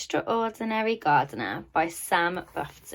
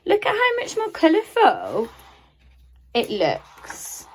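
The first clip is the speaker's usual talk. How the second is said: much higher, bright teacher enthusiasm; delighted; 8 semitones higher than usual. Real, not acted. Teacher shows children the colourful picture, delighted and much higher. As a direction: point out the colour with glee.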